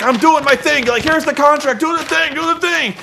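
high-pitched, hammed-up urging; imitated frantic excitement. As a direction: push it up high like an overexcited wrestler. Miz excitedly cashing in.

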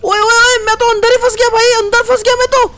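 high, loud panicked cries for help; yelling while stuck inside a trap. Real, not acted. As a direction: shout to be let out, trapped and scared.

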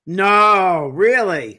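real astonishment at the twist; surprised disbelief. Real, not acted. Host reacts to owl story.

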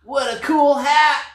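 high, loud snake voice. A narrator's reading. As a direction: cry it out like a snake. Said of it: "cried the snake".

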